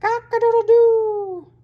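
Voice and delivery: very high, loud, slow crow; rooster crow. A narrator's reading. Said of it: "Bob heard".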